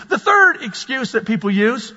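ordinary talk, nothing marked

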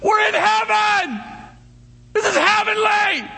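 slow, high, loud cry of delight; joyful shout. Retold or imitated. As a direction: shout it like you've found paradise. Retelling joy at fresh snow.

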